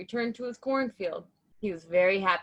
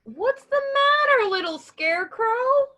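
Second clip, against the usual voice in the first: much higher, loud, slow crow; 7 dB louder than usual. A narrator's reading. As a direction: ask in a sing-song crow voice. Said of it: "asked Crow".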